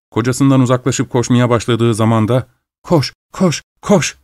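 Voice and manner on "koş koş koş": high, excited cries; restrained high-pitched scream; screaming, lightly acted. A narrator's reading. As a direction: urge the chaser on in a high voice. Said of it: "diye çığlıklar atıyordu".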